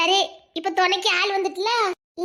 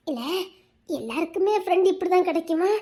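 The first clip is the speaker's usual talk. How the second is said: ordinary talk, nothing marked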